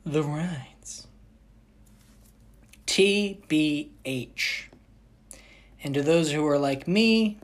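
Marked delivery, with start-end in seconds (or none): 0.0-1.1 dramatic whisper announcing the rides. Acted. Vlogger teasing viewers about the rides, whispering. 2.8-4.7 each letter said slowly and apart; spelled out slowly. Shown as a demonstration. Explaining internet lingo to viewers, slowly spelled.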